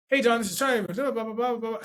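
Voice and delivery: high-pitched hurried babble; nervous-sounding sales rambling. Shown as a demonstration. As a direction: rush the greeting up high, words running together. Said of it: "See, I'm, I'm rambling on".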